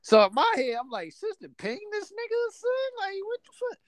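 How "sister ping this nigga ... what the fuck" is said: high squeaky inner-voice disbelief, softer than it sounds; shocked squeak held back in volume; incredulous disbelief. Retold or imitated. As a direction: think it in a high, baffled squeal. Retold inner shock at sister.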